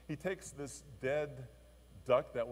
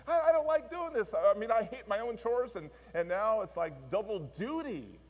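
put-on much higher, louder fed-up kid whine; boy griping about doing his sister's chores; whiny complaint; 6 semitones higher, 7 dB louder than usual. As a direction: complain like a worn-out little boy. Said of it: "He, he's just saying".

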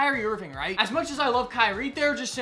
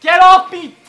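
taunting shout; 7 semitones higher, 14 dB louder than usual. Real, not acted. Taunting opponent.

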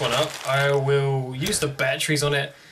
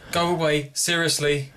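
ordinary talk, nothing marked

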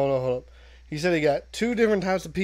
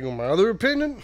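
mock Ricky; 8 semitones higher, 4 dB louder, faster than usual. Mocking Ricky.

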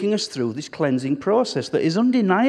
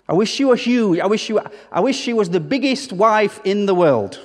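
mock longing; 5 semitones higher, 4 dB louder than usual. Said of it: "Do you look at your wife and say".

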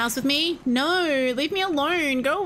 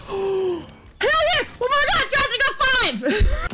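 excited cheer; 12 semitones higher than usual. Real, not acted. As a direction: shriek it out, thrilled. Excited win shout in Fortnite.

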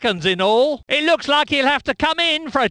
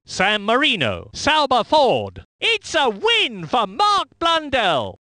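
ordinary talk

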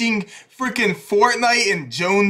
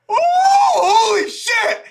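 excited shout; 4 dB louder than usual. Real, not acted. Sees the Smash reveal, screams.

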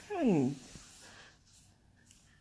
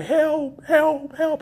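loud, higher mock cries for help; retold scream for help; 6 semitones higher, 7 dB louder, much slower than usual. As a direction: call out for rescue in short bursts. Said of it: "I'm screaming".

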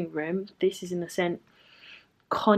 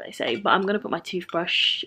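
usual speaking voice

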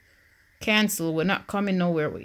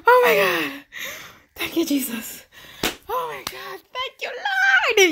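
much higher and louder, near shrieking, laughing and crying on the last words; ecstatic relief; 14 semitones higher, 4 dB louder than usual. Real, not acted. Gamer relieved her Sim survived, shrieking joyful thanks. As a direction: burst out in shrieking joy as the danger passes. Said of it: "Yes, I'm so ex".

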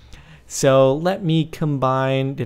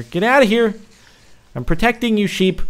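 raised, high shooing shout; urgent shout; 7 semitones higher, 5 dB louder than usual. Real, not acted. Player driving mobs off the sheep, urgent shout. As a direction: shout them away.

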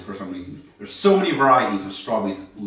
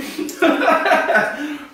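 loud laugh; 5 dB louder than usual. Real, not acted. Bursts out laughing.

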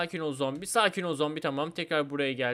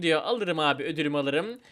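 ordinary talk

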